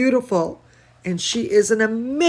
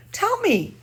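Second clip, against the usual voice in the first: eager high ask; 11 semitones higher than usual. Real, not acted. Coaxing puppet to answer.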